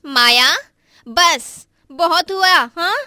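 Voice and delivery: loud sharp rebuke; angry scolding. Acted. Sister-in-law scolding Maya for talking back, loud and angry.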